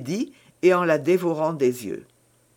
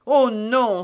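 higher, louder exclamation; emphatic denial; 5 semitones higher, 5 dB louder than usual. A narrator's reading. Milady denies being his mistress, emphatic exclamation. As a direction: cry out the denial with conviction. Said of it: "s'écria Milady".

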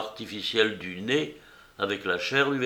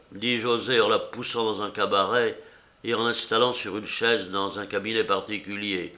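plain reading voice

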